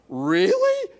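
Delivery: "Really?" shot up very high and loud; a big incredulous squeal to the room; mock disbelief. Student mocking the professor in front of the class with incredulous disbelief. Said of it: "I looked at the class".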